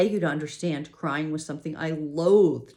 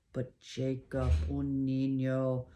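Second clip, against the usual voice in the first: slow, grave dismay; slower than usual. A narrator's reading. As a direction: weigh each word heavily. Rosales grave and alarmed.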